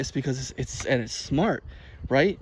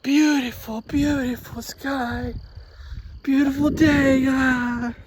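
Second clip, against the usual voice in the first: high, slow, awestruck; awed; 11 semitones higher, much slower than usual. Real, not acted. Vlogger marvelling at the sky, high and slow with awe. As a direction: breathe it out in wonder.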